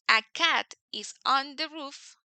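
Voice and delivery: a cat is on the roof, said slowly; slow teacher example; slow demo sentence. Shown as a demonstration. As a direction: stretch each word of the example. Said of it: "si yo digo".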